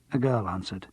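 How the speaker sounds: plain reading voice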